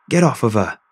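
loud desperate scream. A narrator's reading. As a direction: scream it at the attacker. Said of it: "I screamed".

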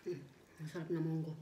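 chewing while talking; speaking between bites; mouth full. Real, not acted. Seen 0: Spoon at mouth at -1.0; lips working over food while speaking.

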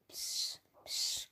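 whispered hiss for attention; sneaky whisper. Acted. Buster trying to get Tom's attention in class, sneaky whisper. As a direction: whisper it furtively across the desk.

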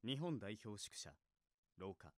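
ordinary talk, nothing marked

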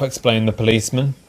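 ordinary delivery, nothing marked

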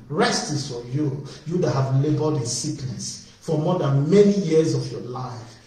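ordinary talk

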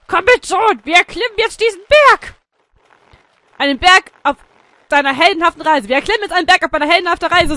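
loud, rousing proclamation; heroic father. Pretend. Father leading son up the mountain, heroic shouting. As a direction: declaim it like a hero setting off.

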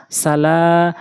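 সালা with লা held long; long second vowel. Shown as a demonstration. Teacher applying madd in a word, long final vowel. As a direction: stretch the end of সালা. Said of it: "প্রয়োগ করে পড়ব".

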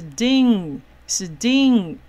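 把 ding 拉长、念得响亮清楚; ding 单独慢慢拼出来; 拼读示范. Shown as a demonstration. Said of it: "那所以边这边这很明显就是一个". (拉长音节示范 ding)

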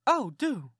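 a keen country aunt pressing him to stay; Aunt Sally. Acted.

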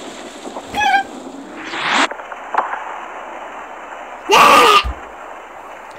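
long unvoiced laughter; breathy laugh. Real, not acted. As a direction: giggle helplessly. Long laugh after fail.

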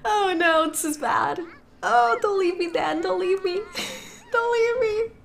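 mock pleading. Begging Dan not to leave.

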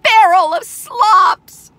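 high, loud, slow proclamation; drawn-out goose shout; honking goose. A narrator's reading. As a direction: squawk it out grandly, stretching words. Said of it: "honked the goose".

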